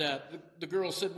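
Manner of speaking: normal talking voice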